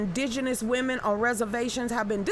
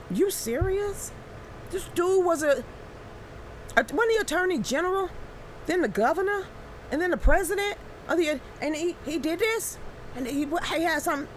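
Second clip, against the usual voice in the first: incredulous; 6 semitones higher than usual. Retold or imitated. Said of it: "It's just like".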